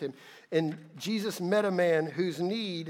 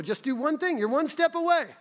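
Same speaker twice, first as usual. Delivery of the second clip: urgent appeal; 10 semitones higher, 4 dB louder than usual. Retold or imitated. Jesus voiced to the ruler.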